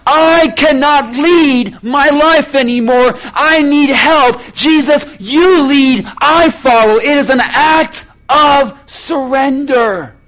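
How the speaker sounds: loud, driving pulpit delivery; full-force preaching. Acted. Preacher urging the congregation to follow, fervent. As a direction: preach it out to the whole church.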